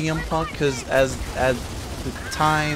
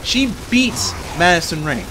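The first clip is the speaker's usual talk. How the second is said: ordinary delivery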